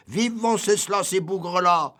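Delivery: acclamation aiguë et forte; cris de foule. Retold or imitated. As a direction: crie comme la foule polonaise. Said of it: "Ils criaient". (cri de foule imité, aigu)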